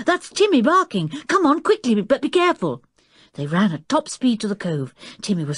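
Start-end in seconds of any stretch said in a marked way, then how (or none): 0.1-2.8 high, urgent call; shouting. A narrator's reading. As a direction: call out in alarm, pitched high. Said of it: "shouted Julian".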